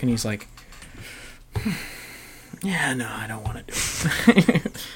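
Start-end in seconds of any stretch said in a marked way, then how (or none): 1.6-4.9 hushed shrugging no; whisper. Retold or imitated. Officer back from shopping, whispering a refusal. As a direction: breathe a lazy refusal. Russian officer quietly declining.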